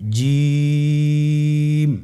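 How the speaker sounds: জিম with the vowel held very long, slow and steady; drawn-out letter name. Shown as a demonstration. Teacher has the class repeat the letter after him, drawn out very long. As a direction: stretch জিম out over three alif lengths. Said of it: "আবার বলুন".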